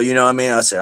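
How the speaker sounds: usual speaking voice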